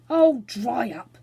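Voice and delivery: slow, loud, fierce retort. A narrator's reading. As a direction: snap at him slowly and loudly. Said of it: "said Robert fiercely".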